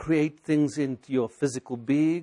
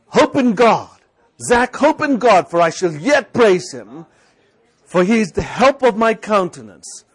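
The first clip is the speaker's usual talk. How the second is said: much higher, louder exhortation; speaking to your soul; 6 semitones higher, 10 dB louder than usual. Shown as a demonstration. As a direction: preach hope into yourself. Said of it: "but speak to your soul".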